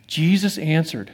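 ordinary delivery

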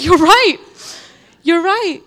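loud, high, emphatic repeat; a loud, high "You're right"; excited agreement. Retold or imitated. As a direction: exclaim it twice as it sinks in.

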